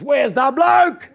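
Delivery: loud, slow, half-laughing question; urgent mate bursting in. Retold or imitated. Mate storming in calls loudly and urgently, half laughing. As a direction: burst in demanding where he is.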